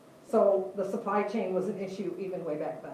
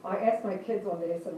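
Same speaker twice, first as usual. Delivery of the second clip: ordinary talk, nothing marked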